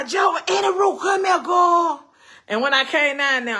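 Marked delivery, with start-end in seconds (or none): 0.0-2.0 high, loud call across the house. Retold or imitated. Husband calling daughter downstairs, loud shout. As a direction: shout for her to come here. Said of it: "He told my".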